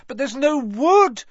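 high loud panicked; cried. Acted. Hermione panicking in the plant, high loud cry. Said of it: "Hermione cried".

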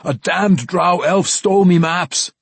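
worked-up dwarf's plea; imploring dwarf. Acted. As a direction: complain loudly and urgently as a robbed dwarf. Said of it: "Bruner implored her".

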